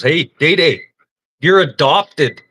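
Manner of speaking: mock call from below; calling up teasingly. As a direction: shout it up the ladder as a joke. Foreman calls up to Day Day.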